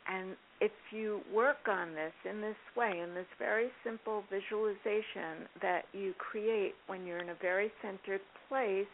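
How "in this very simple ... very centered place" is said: ordinary talk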